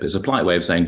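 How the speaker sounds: ordinary talk, nothing marked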